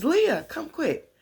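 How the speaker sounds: high, loud, drawn-out call. Retold or imitated. Mama Rose turns to summon Leah, high loud call. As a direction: imitate an urgent summons.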